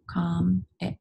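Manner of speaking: "Com, ic" split by a pause; syllable by syllable. Shown as a demonstration. Teacher helping pupils feel the syllables, each said separately. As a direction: break it into "Com" and "ic", evenly spaced. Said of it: "Feel your syllables".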